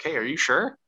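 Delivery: casual check, lifting "sure" into a question; asking. Retold or imitated. Retelling the Emperor asking Vader, questioning. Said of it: "so he's asking him".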